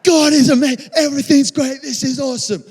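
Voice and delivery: loud, high, exuberant cheering; elated. Acted. Believer after seeing fire fall, elated. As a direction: shout it out, overjoyed.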